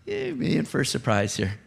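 God's knowing aside. Pretend. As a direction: drop it low and quiet, as a mock warning. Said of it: "And God's like".

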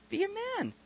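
high, hushed urging; tongue-in-cheek coaxing; mock-loving encouragement. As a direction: imitate a discreet scolding in a squeezed high voice. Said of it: "I very lovingly just began to look at this guy".

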